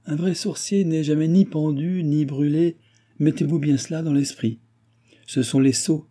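high, smiling assurance. A narrator's reading. Balsamo correcting the baron about sorcerers, smiling. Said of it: "dit en souriant Balsamo".

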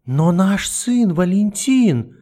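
high, very slow tearful cry; a mother's sobbing wail; sobbing plea. Acted. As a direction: plead through sobs. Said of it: "всхлипнула Матильда".

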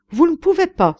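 loud, high-pitched cry of protest; indignant exclamation. A narrator's reading. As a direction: exclaim it as if refused outright. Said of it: "se récria le Polonais".

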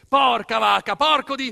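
furious outburst. Acted. Narrator swearing at the unjust verdict, loud and high. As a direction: explode in outrage, then bite off the blasphemy. Curse after lost appeal, cut off.

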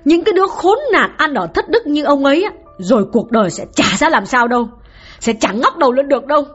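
scolding wife. Acted. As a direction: spit the curse at the man in front of her. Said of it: "nói như mắng vào mặt ông Vương".

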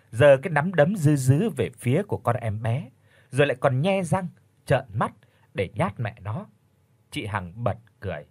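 ordinary delivery, nothing marked

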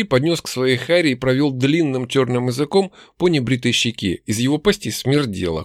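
plain reading voice

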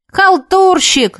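high, slow shout on the run; shouting while running. A narrator's reading. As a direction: yell it back over your shoulder running. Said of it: "закричал на бегу Игорь".

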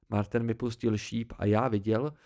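plain reading voice

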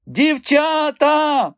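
high, loud, drawn-out call. A narrator's reading. Man hailing girls washing clothes nearby, calling out. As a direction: holler across to them, stretching the word. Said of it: "загукав він до Ренати з Мілкою".

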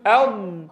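"أض" said with the nostrils held shut; ض with nose pinched. Shown as a demonstration. Teacher showing students "أض" with a closed nose. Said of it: "وقل".

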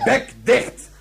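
loud, high angry bark; angry loud command; barked order. Acted. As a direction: shut him up with a shout. Colonel silences the prisoner.

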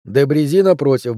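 ordinary delivery, nothing marked

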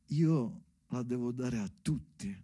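ordinary talk, nothing marked

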